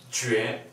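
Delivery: jue steigend im zweiten Ton; second tone. Shown as a demonstration. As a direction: jue nach oben ziehen. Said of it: "Second tone".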